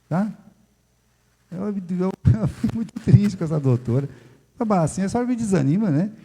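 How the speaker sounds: ordinary talk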